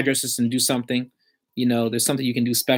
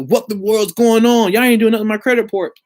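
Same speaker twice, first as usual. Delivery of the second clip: higher and louder, exasperated; a fed-up client letting loose on the phone, mock angry; upset slang voice; 7 semitones higher, 4 dB louder than usual. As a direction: let the slang come out when upset. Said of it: "yo, that comfortable".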